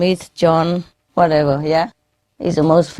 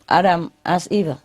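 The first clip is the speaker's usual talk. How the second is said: normal talking voice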